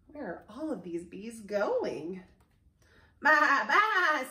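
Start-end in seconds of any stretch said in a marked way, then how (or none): 3.2-4.2 loud, higher-pitched bleats; two long loud baas; sheep baa. A narrator's reading. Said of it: "says Sheep".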